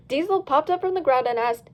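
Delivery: ordinary delivery, nothing marked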